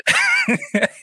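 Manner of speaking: high squealing laugh; shrieking laugh. Real, not acted. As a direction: burst into a high giggle.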